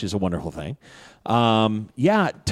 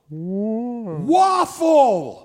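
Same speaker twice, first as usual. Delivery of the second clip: high, drawn-out yell; garbled yell; 10 semitones higher, much slower than usual. Acted. Game master as poisoned Titus, nonsense yell. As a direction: yell it out long and woozy. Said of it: "and you yell".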